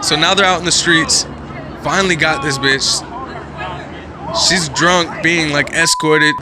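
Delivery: loud, excited play-by-play; excited commentary. Real, not acted. Reacting to the fight video, loud and excited. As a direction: call it as it happens.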